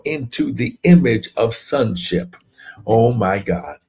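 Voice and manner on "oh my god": normal talking voice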